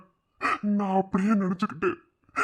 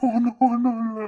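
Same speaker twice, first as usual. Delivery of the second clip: tearful denial through crying; 4 semitones higher, 4 dB louder than usual. Acted. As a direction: choke out the words while crying. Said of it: "இப்ப எதுக்குடா அழுகுற?". (அழுகையோடு மறுப்பு)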